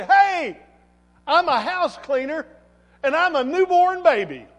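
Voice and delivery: loud, high, emphatic comeback; defiant retort. Acted. Preacher acts out believer answering the devil, defiant shout.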